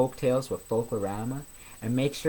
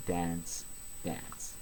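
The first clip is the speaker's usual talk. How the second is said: softer and softer until a whisper; fading away; 6 semitones lower, 11 dB quieter than usual. A narrator's reading. Narrator closing the dance, fading to a whisper. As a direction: let the last words die to a whisper.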